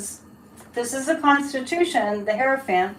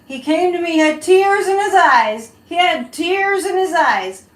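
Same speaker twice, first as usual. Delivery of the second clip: lightly voiced Trump, higher and louder; self-admitted bad Trump impression, higher; 6 semitones higher, 6 dB louder than usual. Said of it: "I can't do a Donald Trump".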